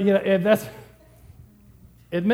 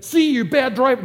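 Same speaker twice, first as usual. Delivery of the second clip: mock scolding; 7 semitones higher, 7 dB louder than usual. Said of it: "I said".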